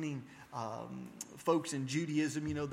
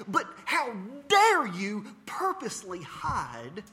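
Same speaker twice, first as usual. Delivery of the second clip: louder, slow, accusing demand; outraged how dare; 8 semitones higher, 9 dB louder, slower than usual. Retold or imitated. Caller's outrage at her rabbi, retold slow and loud. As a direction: lean hard into how dare. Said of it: "I got so mad".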